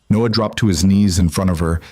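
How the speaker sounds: usual speaking voice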